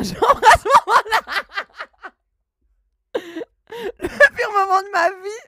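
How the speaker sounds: high, laughing through the words; laughing in embarrassment. Real, not acted. Streamer recalls her father walking in, laughing helplessly. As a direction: crack up while confessing the cringe.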